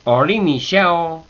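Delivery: "Ori mi sheo" slow, high and loud on "ori" and "she"; accented syllables. Shown as a demonstration. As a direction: hit "ri" and "she" with exaggerated high stress. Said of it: "She, ahí va con, con acento".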